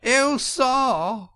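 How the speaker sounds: high, wailing falsetto cry; very high tearful wail. A narrator's reading. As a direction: Toad wailing as a distressed washerwoman. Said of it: "cried the toad, crying afresh".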